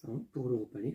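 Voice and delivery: ordinary talk, nothing marked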